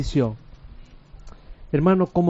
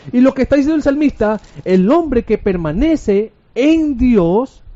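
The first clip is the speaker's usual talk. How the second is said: raised, driving sermon voice; impassioned preaching; 9 semitones higher, 5 dB louder than usual. Real, not acted. Preacher assuring the congregation God keeps them from losing heart, impassioned preaching. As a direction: preach with force, pitch held high.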